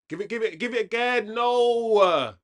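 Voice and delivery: real fan building up to a loud cry; rising urgent excitement. Real, not acted. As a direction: urge the player on, building to a yell. Urging team to score, rising.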